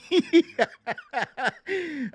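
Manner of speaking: high burst of delighted laughter; bright chuckle of joy; proud laugh. Real, not acted. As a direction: laugh with pride. Laughing about son.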